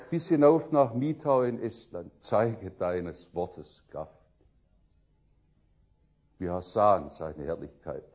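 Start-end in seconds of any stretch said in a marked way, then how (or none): 2.2-8.0 low, slow, reverent quotation; solemn refrain. Acted. Preacher sealing his list of rescue homes with scripture, solemn. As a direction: let the verse sink in, deep and measured.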